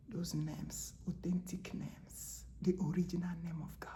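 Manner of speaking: hushed, secret-telling whisper. Real, not acted. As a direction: drop to a reverent whisper. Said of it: "unvoiced whisper".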